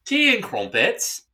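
slow, high, hammed-up English voice; mock English accent. Host answers "tea and crumpets" in a mock English accent. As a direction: savour it like a posh Londoner. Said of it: "doing the English accent".

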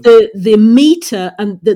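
ordinary delivery

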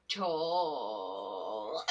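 "tall" stretched long, low and soft; drawn-out word. Retold or imitated. Pupil describing his women to teacher, "tall" drawn out low. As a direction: draw out "tall" in a low, dreamy sink.

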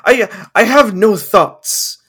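loud and slow, drawn out; exasperated outburst. Real, not acted. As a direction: throw up your hands, loudly.

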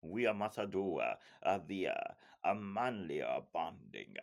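bad Italian accent, extra "uh" after words; Massimo. Mocking Massimo's invitation to bond, bad Italian accent. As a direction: ham up a fake Italian accent with added vowel endings. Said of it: "the worst Italian accent you've ever heard".

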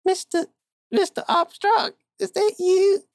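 a panicked client bursting in; hysterical woman. Acted. Hysterical woman bursts into the detective's office, high and frantic. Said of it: "standing in hysterics".

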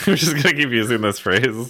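talking through a laugh. Real, not acted. Laughing at own running joke.

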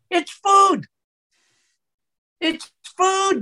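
can't contain the excitement, pitch shoots up; excited outburst. Real, not acted. Doctor excitedly insisting to the host it's food, pitch jumping up. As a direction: voice jumps high with excitement. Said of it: "my voice goes up when I get excited".

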